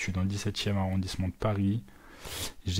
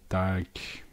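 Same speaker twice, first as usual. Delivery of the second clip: usual speaking voice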